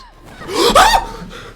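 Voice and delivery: hammed-up high laughing gasp; overacted gasp into a laugh; mock gasp. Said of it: "React like I'm playing Amnesia?".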